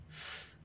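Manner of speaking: "f" as a soft breath through teeth on lip; unvoiced f. Shown as a demonstration. Teacher to pupils, blowing the F sound. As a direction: blow air for "f", no voice. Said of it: "juntamos los dientitos con el labio y sacamos el aire".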